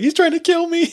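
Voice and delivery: very high, panicked imitation; mock terrified shriek. Terrified kid phones for help after knife attack, shrieking. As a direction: squeal it like a horror-film victim. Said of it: "Really Shelley Duvall right there".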